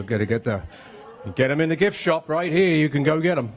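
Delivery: usual speaking voice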